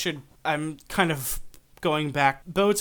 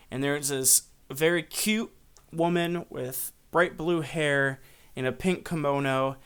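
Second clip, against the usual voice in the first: normal talking voice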